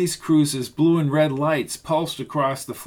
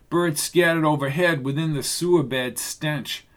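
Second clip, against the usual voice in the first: plain reading voice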